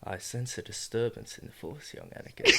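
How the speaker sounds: hushed mock Jedi warning; solemn Jedi voice. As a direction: murmur it like a wise old Jedi. Star Wars quote riffing.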